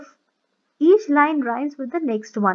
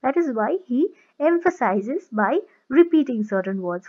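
ordinary talk, nothing marked

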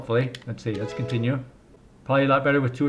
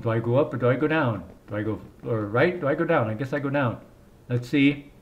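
ordinary talk

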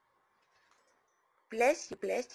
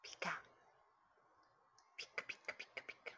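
whispered pika; 6 semitones lower, 17 dB quieter, much faster than usual. Real, not acted. Cooing to parrot.

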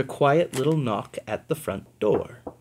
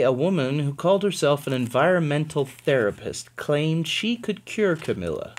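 ordinary delivery

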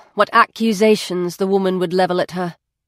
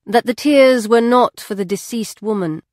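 ordinary delivery, nothing marked

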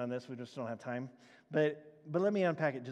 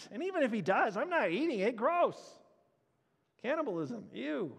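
disgusted crowd; 7 semitones higher than usual. Retold or imitated. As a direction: recoil as the grossed-out crowd. Crowd disgusted by flesh eating.

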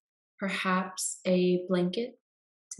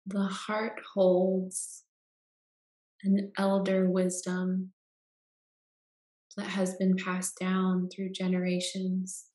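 guided meditation. Acted. Guide leading listeners into Yoga Nidra rest, calm meditation voice.

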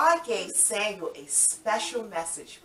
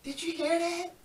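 surprised; 9 semitones higher, 4 dB quieter than usual. Acted.

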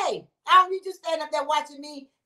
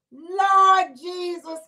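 slow drawn-out Lord Jesus; drawn-out lament; 4 semitones higher, 4 dB louder, much slower than usual. Real, not acted. Livestreamer lamenting the sub news, slow drawn-out sigh. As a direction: stretch it out like a prayer.

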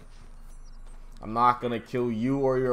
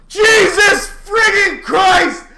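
much higher, louder, slow drawn-out yell; furious shout; 13 dB louder, much slower than usual. Real, not acted. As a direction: bellow in disbelief. Raging at the game.

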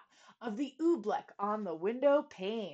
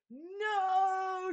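shouted; 10 semitones higher than usual. A narrator's reading. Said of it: "shouted Bartholomew".

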